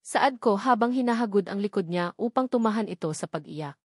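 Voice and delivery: plain reading voice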